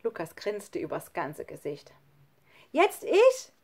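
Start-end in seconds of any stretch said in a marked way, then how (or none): 2.7-3.5 put-on very high, loud child's voice; eager call. A narrator's reading. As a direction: pipe up loudly for your turn. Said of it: "rief Nele". (hoch und eifrig gerufen)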